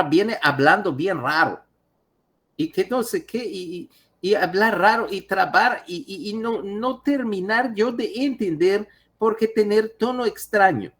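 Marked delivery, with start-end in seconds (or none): 2.6-10.9 put-on high, halting broken Spanish with a foreign accent; exaggerated foreign accent. Doctor imitating the Bulgarian patient's foreign accent, exaggerated. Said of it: "yo lo estoy exagerando".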